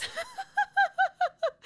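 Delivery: high-pitched embarrassed laugh; high laugh at self. Real, not acted. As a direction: laugh at your own blunder. Laughing at own mistake.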